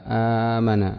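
ordinary talk, nothing marked

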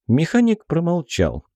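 ordinary delivery, nothing marked

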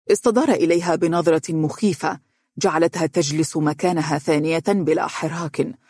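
ordinary delivery, nothing marked

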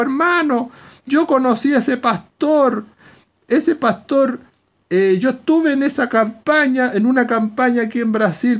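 high-pitched eager church sister, imitated; excited testimony. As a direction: speak up eagerly from the congregation. Acts sister testifying from congregation.

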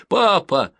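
put-on high, loud child's wail; a boy calls for his father; child crying out. A narrator's reading. Boy cries out to the sergeant in a high wail.

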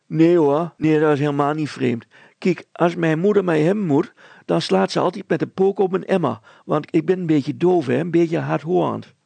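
put-on high, loud, tumbling girl's voice; a breathless girl blurting out what she saw; flustered girl. Petronella explains deafness.

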